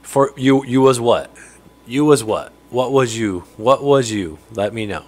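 ordinary talk, nothing marked

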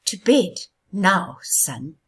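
loud, slow forceful command; strong gust. Acted. Wind gusting strongly at the sun, loud and slow. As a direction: blow the words out hard and slow. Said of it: "the wind gushed strongly".

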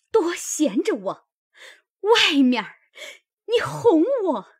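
wife blocking the door, cursing the maid and her husband; furious cursing. Acted. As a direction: let the rage climb until she is shouting. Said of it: "便堵着门站着骂道".

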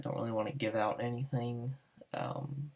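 ordinary talk

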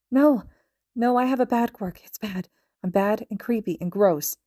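distraught. A narrator's reading. Toga upset, self-loathing.

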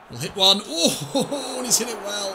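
rising excited commentary; excited shot call. Real, not acted. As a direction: call the strike with a laughing gasp.

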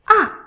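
"ah" short, loud, falling from high; fourth tone. Shown as a demonstration. Teacher reviews the tones, strong falling "ah". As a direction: drop "ah" hard from the top. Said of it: "Let's review the tones".

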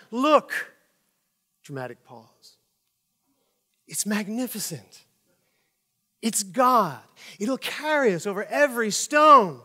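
dramatic movie line. Acted. Passenger quoting Ethan Hawke at the window, hammed-up drama. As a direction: declaim it like a stirring movie speech. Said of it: "you recite the line".

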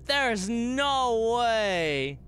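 high, long drawn-out exclamation; amazed disbelief. Real, not acted. Stunned by the cameo.

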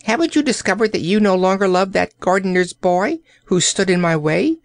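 high, quick questioning; demanding. A narrator's reading. Old courtier questioning the princess about her love, demanding. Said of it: "he demanded".

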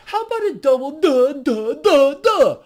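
high, loud, repeated duhs; mocking duh. Shown as a demonstration. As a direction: pile the duhs up, each one punched. Offers an exaggerated double duh.

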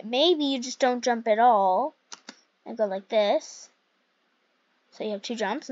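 normal talking voice

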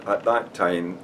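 ordinary talk, nothing marked

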